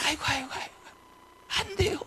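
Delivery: soft, high-pitched helpless whimper; hammed-up feeble lament; mock faithless moaning. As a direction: imitate a believer who has given up. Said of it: "성도들이 믿음이 현찮으니까".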